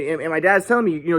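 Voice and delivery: usual speaking voice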